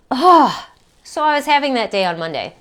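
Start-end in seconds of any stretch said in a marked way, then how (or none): 0.1-0.7 heavy exhaled "oh"; weary sigh. Real, not acted. Woman pausing after listing her confusing feelings, weary sigh. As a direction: let out a big sighing oh.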